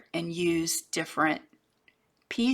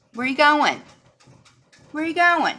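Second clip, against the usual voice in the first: high, loud call swooping down; raised pet-scolding voice, high then falling; 9 semitones higher, 8 dB louder than usual. Real, not acted. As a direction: call after a wandering pet. Calling out to pet Gracie.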